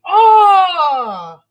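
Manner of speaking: loud delighted gasp of surprise; a sudden high, loud cry of joy; excited cry. Real, not acted. Gasps at the big book.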